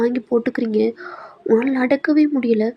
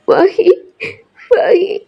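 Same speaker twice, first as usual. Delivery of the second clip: high tearful call of a name; crying; 8 semitones higher, 4 dB louder, much slower than usual. Acted. Girl sobs to her friend Mahi on the phone.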